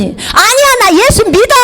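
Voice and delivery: high, emphatic mock protest; protesting outcry. Imitating a husband insisting to his wife he believes, protesting. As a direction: blurt out an indignant denial.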